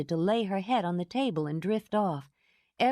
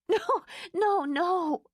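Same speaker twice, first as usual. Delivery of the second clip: high breathless protest; fighting laughter; 13 semitones higher, slower than usual. A narrator's reading. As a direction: try to stop laughing. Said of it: "Fighting for control".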